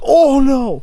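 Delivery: high, sudden "oh no" cry; alarmed. Retold or imitated. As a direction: yelp of dismay. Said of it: "And I'm like".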